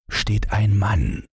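ordinary delivery

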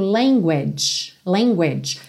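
"language" ending in a "tch" sound. Shown as a demonstration. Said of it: "Você termina nesse som". ("language" terminando em tch)

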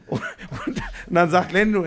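usual speaking voice

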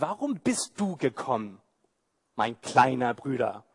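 older brother mocking David, softly scornful. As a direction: sneer down at the little brother. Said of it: "Man hört den Spott in seiner Stimme".

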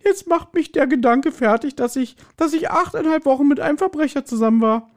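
hammed-up sobbing, voice way up. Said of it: "Tanja begann wieder zu heulen".

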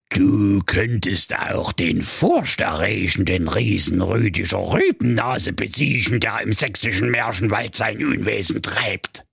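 put-on old fairy-tale witch. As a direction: lure them in like a gingerbread witch. Witch second task. (alte Hexe an der Gegensprechanlage)

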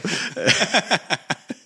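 a high burst of laughter; real laugh. Real, not acted. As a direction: laugh at your own joke. Lacht über eigenen Witz. (hohes, herzhaftes Lachen)

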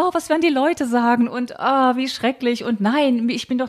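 put-on high, fretful old-aunt voice; high-pitched fussy spinster lament; mocking fussy aunt. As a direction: whine it like a prim, worried aunt. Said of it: "so ganz anders war und so immer so".

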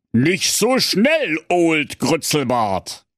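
slow, high showdown challenge; western gunslinger drawl. Acted. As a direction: stretch it out like a cowboy duel.

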